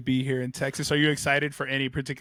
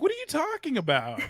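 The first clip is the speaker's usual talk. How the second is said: retold protest; 12 semitones higher than usual. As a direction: retell it as high, incredulous protest. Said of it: "I was like".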